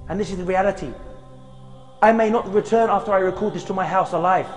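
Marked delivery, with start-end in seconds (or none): none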